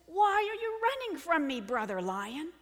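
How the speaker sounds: loud, high demand dropping at the end; baffled lion. Acted. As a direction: call out to a fleeing brother. Lion confronts the fleeing lion.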